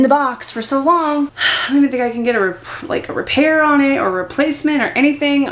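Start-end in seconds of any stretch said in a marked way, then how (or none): none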